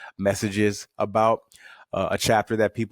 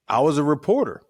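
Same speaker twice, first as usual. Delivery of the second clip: higher, news-anchor delivery; reporter voice; 5 semitones higher than usual. Shown as a demonstration. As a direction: talk like a TV reporter on air. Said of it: "I would talk in the reporter voice like that".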